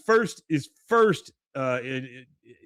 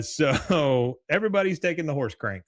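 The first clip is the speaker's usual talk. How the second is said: ordinary delivery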